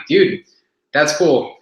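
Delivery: troll him with a smug brag; trolling the gym guy about his shorts, smug and teasing; mocking taunt. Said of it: "I'm like".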